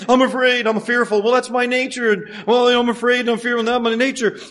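a hint of a self-pitying excuse; mock whiny excuse. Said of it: "keep saying".

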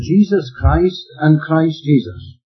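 "Jesus" stressed in "Jesus Christ", "Christ" stressed in "Christ Jesus", said slowly; slow contrast, lean on the first name each time; teacher contrasting word order. Shown as a demonstration. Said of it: "The emphasis always falls on the first word".

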